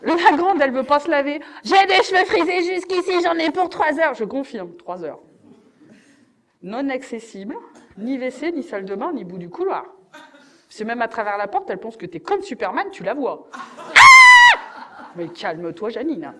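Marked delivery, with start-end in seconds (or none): 1.6-4.1 mock teen protest. Daughter refusing to wash. 13.5-15.1 very high, loud shriek; shrill startled scream. Retold or imitated. Daughter startled behind the bathroom door, shrieking loudly.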